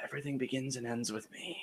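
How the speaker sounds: very soft, centering murmur; hushed self-calming mantra; meditating. Pretend. Host centers himself after spiraling, meditative hush. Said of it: "are you meditating right now".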